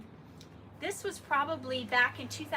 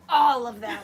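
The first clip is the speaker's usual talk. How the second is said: louder flat verdict; blunt mentor verdict; 2 semitones lower, 11 dB louder than usual. Retold or imitated. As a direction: deliver it without mercy. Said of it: "He goes".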